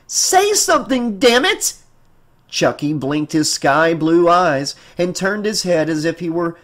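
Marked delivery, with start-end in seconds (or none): 0.0-1.8 high, forceful exasperated cry; furious demand. Acted. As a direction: order the doll to speak, patience gone. Mother demands again that doll speak.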